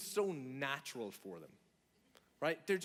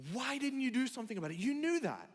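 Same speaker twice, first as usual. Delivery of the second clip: higher, quick, exasperated scolding; pitched-up, rapid reproach; indignant master; 7 semitones higher than usual. Acted. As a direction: scold a servant who should have known. Master scolds servant in parable.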